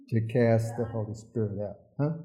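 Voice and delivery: usual speaking voice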